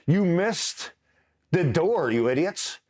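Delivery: a touch of mock anger at the idiots; mock scolding. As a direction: chew them out in mock disbelief. Scolding the missed shot.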